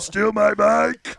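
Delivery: slow, high, put-on accusing yell; accusing character voice. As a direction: stretch out each word as you accuse. Repeats the line as Pee Wee.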